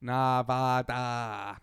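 "Nevada" very slow, every vowel AH: nah-vah-tah; hammed-up, drawn-out "Nevada" with open vowels; exaggerated pronunciation. Shown as a demonstration. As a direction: stretch out each syllable of "Nevada".

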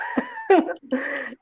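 high giggle. Real, not acted. Giggling at own answer.

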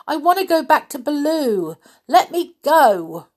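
high, loud protest; yelling. Acted. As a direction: shout to be let go. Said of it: "he yelled".

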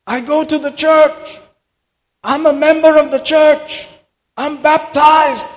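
high, loud pleading cry to be heard; desperate cry. Retold or imitated. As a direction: cry out in panic as he sinks. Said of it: "begins to shout, begins to cry out".

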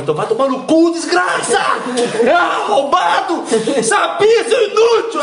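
mock rage climbing into high-pitched shouting; retold angry rant. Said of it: "esse dia eu tava com raiva".

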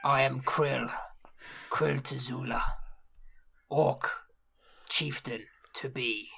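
low, quiet, measured orc voice; orc chieftain. Acted. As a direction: announce yourself in solemn, separated phrases. Introduces self as orc chieftain.

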